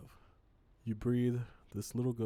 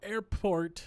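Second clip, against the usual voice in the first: higher, louder test voice; trying out a voice; 9 semitones higher, 6 dB louder than usual. Shown as a demonstration. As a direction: try a passenger voice on. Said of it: "I don't know what his voice is gonna be".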